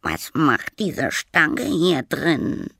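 high, very slow ghostly voice; little ghost voice. A narrator's reading. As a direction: stretch every word like a small spook. Ghost voice from keyhole. (hohe, sehr langsame Gespensterstimme)

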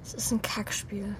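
barely audible frustrated whisper. Acted. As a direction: mutter it under your breath, defeated. Bela murmelt frustriert zu sich. (leise geflüstert, frustriert)

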